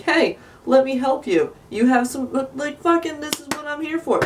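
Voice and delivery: high, mocking sing-song helper voice; sarcastic high-pitched helper; mock helpful coworker. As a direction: sneer it like a fake offer of help.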